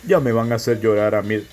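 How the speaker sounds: host voice. Acted. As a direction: step out of the scene as host. Presenter voice outside the sketch.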